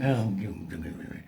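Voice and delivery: low, fading sorry-for-yourself moan; poorly groan. Retold or imitated. Feeling poorly, talking to herself, low fading moan. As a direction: moan it like you're feeling ill. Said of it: "you think".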